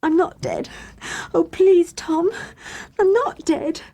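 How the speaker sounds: high, tearful pleading; crying plea. A narrator's reading. Girl sobbing to Tom, pleading she isn't dead. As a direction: beg through tears not to be thought dead. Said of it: "Hattie was crying".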